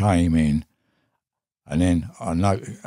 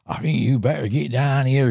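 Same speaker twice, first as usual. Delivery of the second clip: higher-pitched, lightly mimicked Yorkshire accent; imitated Yorkshire accent; 8 semitones higher than usual. Mate phones Pete about the fish, imitated Yorkshire accent. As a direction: put on a touch of Yorkshire, pitched well up. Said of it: "in his Yorkshire accent".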